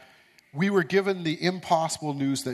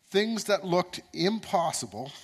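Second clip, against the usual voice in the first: usual speaking voice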